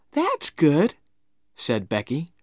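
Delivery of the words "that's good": put-on high-pitched, pleased girl; approving little girl. A narrator's reading. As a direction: approve the drawing in a high girlish voice. Becky agrees, pleased.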